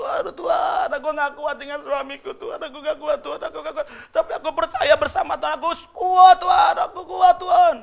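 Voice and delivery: groaning prayer. Acted. Wife in labor cries to God, high wail building to shout. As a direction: wail to God, then shout your resolve. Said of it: "Ngerang gitu".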